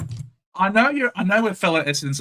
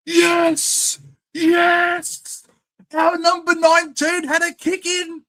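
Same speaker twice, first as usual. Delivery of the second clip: excited cheer; 10 semitones higher, slower than usual. Real, not acted. Fan celebrating number nineteen's goal: excited cheer. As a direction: cheer like your team just scored. Celebrating his team's player scoring.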